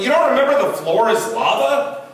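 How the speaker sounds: mock-outraged fan explaining a childhood game; passionate game rules. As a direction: lay down the sacred rule with passion. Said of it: "He's like".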